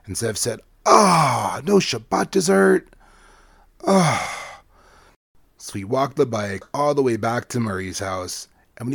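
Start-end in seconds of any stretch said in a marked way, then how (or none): 0.8-4.6 dismayed groan. Acted. Zev dismayed at punishment.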